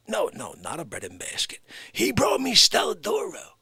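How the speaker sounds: wiseguy on the phone to heaven; mock mobster on phone. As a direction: play a tough guy sweet-talking Saint Peter on the phone. Mock phone call as mob guy.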